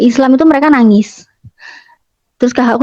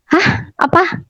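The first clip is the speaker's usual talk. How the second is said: surprised; 7 semitones higher, 5 dB quieter than usual. Retold or imitated. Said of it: "Terus mamaku ya".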